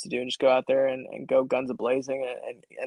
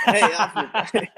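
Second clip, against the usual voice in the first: scolding the dog; 6 semitones higher, 5 dB louder than usual. Pretend. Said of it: "I call him Alfred".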